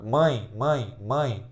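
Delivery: MÃE repeated with nasal resonance; low demonstration of MÃE, sound sent through the nose; nasal diphthong. Shown as a demonstration. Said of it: "o dedo no nariz".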